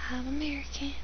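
a quick hushed whisper. Real, not acted. Whispering to viewers about her nationality. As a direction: drop to a whisper for the confession. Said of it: "if you can't tell by my accent".

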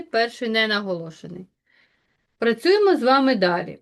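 ordinary delivery

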